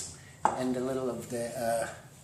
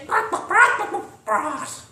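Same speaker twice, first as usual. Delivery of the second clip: high, loud clucked "broth" like a hen; chicken clucking; 12 semitones higher, 7 dB louder than usual. Pretend. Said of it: "Or as they say in chicken".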